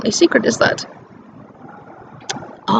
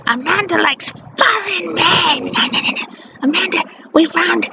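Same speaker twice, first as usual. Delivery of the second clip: mocking taunt; 6 semitones higher than usual. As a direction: tease her like a nasty sibling. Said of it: "They used to call me stupid names like".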